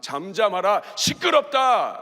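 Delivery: loud, drawn-out scolding; crowd's rebuke. Acted. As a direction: silence him as the annoyed crowd. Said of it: "사람들이 막".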